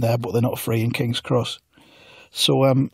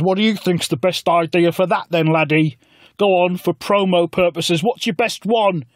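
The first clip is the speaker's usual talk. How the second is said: higher, slow put-on voice; mock Corbyn; 5 semitones higher than usual. Corbyn asks the narrator for an idea, higher and slow. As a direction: press for an answer in a silly voice.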